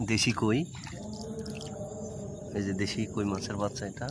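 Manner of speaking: soft, hushed commentary by the water. Real, not acted. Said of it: "মাছ ধরলে নাকি আস্তে আস্তে কথা বলতে হয়".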